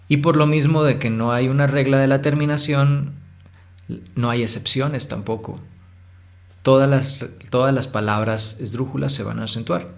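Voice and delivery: usual speaking voice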